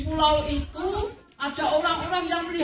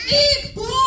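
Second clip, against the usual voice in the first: anguished cry; 12 semitones higher, 5 dB louder than usual. Acted.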